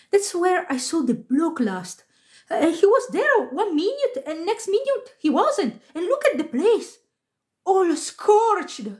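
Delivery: high-pitched complaining worker; agitated stage hand. A narrator's reading. Stage hand to stage manager, agitated high voice.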